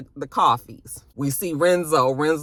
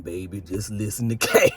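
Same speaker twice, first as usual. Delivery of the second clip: lower, calming voice; soothing husband; 9 semitones lower than usual. Retold or imitated. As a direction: talk the wife down in a low voice. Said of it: "He's like".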